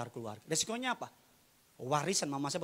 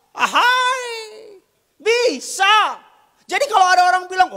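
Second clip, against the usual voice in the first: high, loud sermon shout; loud, high-pitched rhetorical preaching; full-force preaching; 19 dB louder, slower than usual. Real, not acted. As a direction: drive the point home to the congregation.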